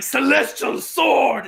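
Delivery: slow, drawn-out, high battle shout; hero's attack cry. Retold or imitated. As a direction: bellow the sword move like an anime hero. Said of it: "and he's like".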